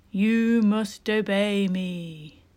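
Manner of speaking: a boy playing a ghostly dead king, slowly. Boy pretends to be mummy, spooky slow king voice. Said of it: "the voice of the dead king".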